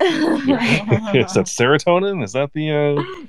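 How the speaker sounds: high, slow giggling question; laughing. Real, not acted. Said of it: "laughing while asking".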